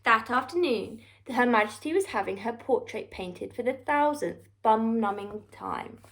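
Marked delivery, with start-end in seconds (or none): none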